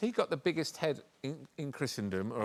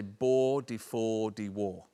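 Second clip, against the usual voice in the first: slow, drawn-out Northern Irish accent; a lightly done Northern Irish accent; much slower than usual. Shown as a demonstration. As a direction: sound it out slowly, Northern Irish. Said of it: "taught me how to do a Northern Irish accent".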